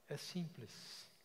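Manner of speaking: quiet, gentle close; soft and tender. Real, not acted. Closing softly.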